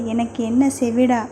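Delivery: ordinary delivery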